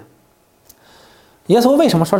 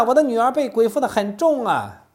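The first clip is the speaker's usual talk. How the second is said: pleading cry; 7 semitones higher than usual. Acted. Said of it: "她大声喊着说".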